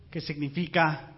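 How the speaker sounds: ordinary talk, nothing marked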